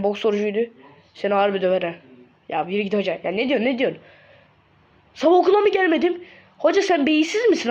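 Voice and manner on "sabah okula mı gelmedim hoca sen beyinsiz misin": high, heated objection; indignant protest. Acted. Pupil argues with his teacher over attendance, high indignant voice. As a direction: protest with pitch shooting up.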